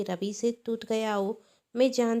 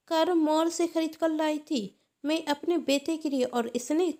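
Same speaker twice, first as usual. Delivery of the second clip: loud, higher furious rant; aunt yelling at the boy hiding behind his mother; angry shouting; 5 semitones higher, 4 dB louder than usual. Acted. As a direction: shout her down over the broken toy. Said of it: "Radhika कहती".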